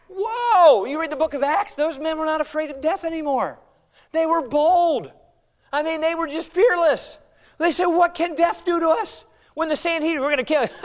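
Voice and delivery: high, forceful preaching; fired-up preaching. Real, not acted. Preacher on the apostles' fearlessness in Acts, fired-up preaching. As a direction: proclaim it boldly, pitch lifted.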